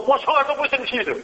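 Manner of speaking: high-pitched mock-angry insistence; angry denial. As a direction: act out the doctor angrily denying his anger, pitched high. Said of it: "他很生气的说". (高声假装生气地否认)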